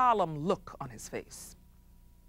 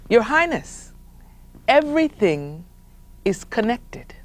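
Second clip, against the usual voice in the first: solemn wise man; 4 semitones higher, 6 dB louder than usual. Acted. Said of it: "and said".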